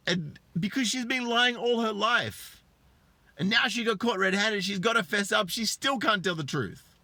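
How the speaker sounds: high-pitched, emphatic exasperation; indignant rant. Real, not acted. Commentator rails at a wife caught red-handed, indignant. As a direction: rail at her with the voice way up. Ranting about wife still lying.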